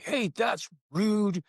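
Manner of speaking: slow, drawn-out stern rebuke; hammed-up angry parent; mock scolding. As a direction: play the lecturing parent. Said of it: "So now I could get angry".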